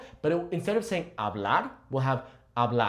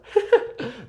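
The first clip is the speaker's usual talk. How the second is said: giggle; 9 semitones higher than usual. Real, not acted. Giggling before the tongue twister.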